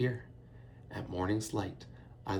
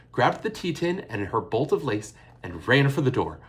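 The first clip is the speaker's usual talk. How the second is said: usual speaking voice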